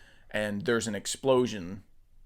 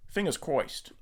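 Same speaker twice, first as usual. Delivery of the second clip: lightly voiced Penguin villain; a hint of a squawky gangster; penguin accent; 5 semitones higher, slower than usual. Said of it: "that's my penguin accent".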